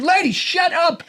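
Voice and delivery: very high, drawn-out comic anger; exasperated outburst. Retold or imitated. Panicked man to meddling lady at next table, exasperated. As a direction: silence a busybody with a high, strained outburst.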